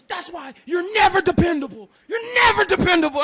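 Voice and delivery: loud high mock yell; imitating angry girlfriend. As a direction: hurl it like an accusation. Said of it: "she's like".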